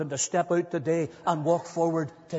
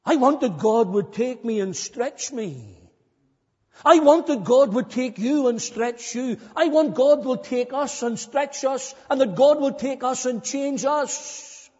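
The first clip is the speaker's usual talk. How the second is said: louder, higher, insistent pulpit plea; impassioned preaching; 7 semitones higher, 5 dB louder than usual. Real, not acted. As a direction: drive each line home to the congregation. Preaches at full force.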